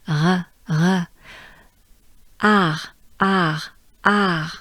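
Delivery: "R" breathed out, then led by an open A; French R. Shown as a demonstration. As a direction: exhale each "R" with tongue down. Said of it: "your tongue stays down in your mouth".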